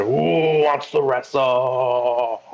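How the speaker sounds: slow, stretched-out announcer-like call; long, drawn-out challenge to the room. Acted. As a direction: draw the words right out to rally challengers. Player announces a wrestling challenge in character.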